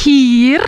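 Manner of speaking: Hier with a breathy H up front; hír with H. Shown as a demonstration. Teacher shows Hier with H. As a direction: aspirate the H in Hier. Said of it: "toujours avec le H".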